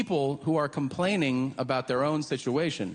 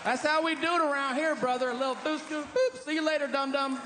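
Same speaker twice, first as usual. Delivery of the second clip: put-on Texan good ol' boy; 12 semitones higher than usual. Comic imitating Texans, mocking and cocky. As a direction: play a swaggering Texan yokel.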